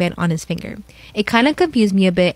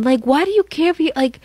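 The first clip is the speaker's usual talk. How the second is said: exasperated; 5 semitones higher than usual. Real, not acted. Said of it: "reacting to the post".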